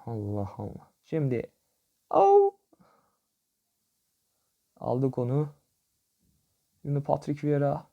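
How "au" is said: pained yelp. Real, not acted. Reacting to a missed chance.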